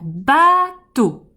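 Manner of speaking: "bateau" with a loud, long-held ba; "bateau" in two slow, loud beats; stressed first syllable. Shown as a demonstration. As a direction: punch and stretch the ba of "bateau". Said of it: "On va accentuer la première syllabe".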